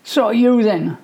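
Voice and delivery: snipe back at him; childish taunt. A narrator's reading. Brothers trading insults.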